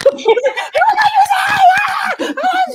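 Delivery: drawn-out, very high fan shriek; starstruck screaming. Retold or imitated. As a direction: scream and laugh, overwhelmed by your idol. Retells screaming at her idol.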